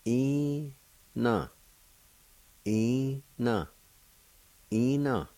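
"ina" with a long, high first vowel and falling end; pronunciation demo. Shown as a demonstration. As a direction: draw out the first syllable of "ina", drop on the second. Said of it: "It's pronounced like this".

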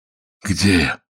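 weak, strained hungover voice; forced out with difficulty. A narrator's reading. Dazed prisoner asking the warder where he is, strained. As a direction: struggle to get it out. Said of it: "с трудом выдавил Спирька".

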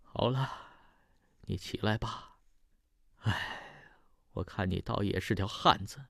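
a hint of weeping as she relents; crying softly. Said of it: "哭着说". (老太太哭着、轻声原谅)

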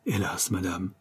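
sorrowful tearful voice. A narrator's reading. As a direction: say it as if holding back tears. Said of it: "d'une voix dans laquelle il y avait des larmes".

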